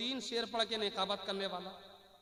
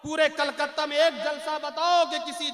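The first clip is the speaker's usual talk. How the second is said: high, forceful public protest; impassioned loud preaching; 6 semitones higher, 18 dB louder than usual. Real, not acted. Preacher challenging Kolkata about slighted Quran reciters, loud impassioned protest. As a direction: cry out the grievance to the whole gathering.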